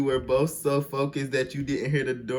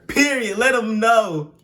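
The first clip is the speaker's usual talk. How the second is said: much higher and louder; hyped; 9 semitones higher, 8 dB louder, slower than usual. Real, not acted. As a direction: cheer her on. Hyped reaction.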